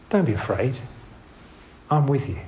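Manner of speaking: soft, gentle reassurance; reassuring. Shown as a demonstration. God reassuring a frightened listener, soft and reassuring. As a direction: comfort them, no judgement. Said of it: "you can change the tone of voice".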